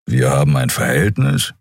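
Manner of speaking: low, dry growl of a big creature; Kero, dry and low. A narrator's reading. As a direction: answer low and dry, unimpressed. Kero's dry reply. (Kero antwortet trocken, tiefe Stimme)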